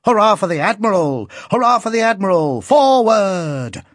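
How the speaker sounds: high, loud repeated cheer; ringing hurrahs building to a command; boy's rousing cry. A narrator's reading. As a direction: cheer on the troops like an excited boy. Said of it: "cried the boy".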